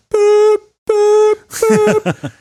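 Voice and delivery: high, loud electronic beeps; imitated alert beeps. Recalling the tornado alert with high loud beeps. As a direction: beep like an emergency broadcast tone.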